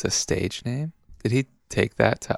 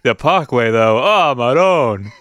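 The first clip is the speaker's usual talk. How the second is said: louder, slow mock New Jersey lament; New Jersey accent; 7 dB louder than usual. Jersey local sighing over the parkway, New Jersey accent. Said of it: "New Jersey".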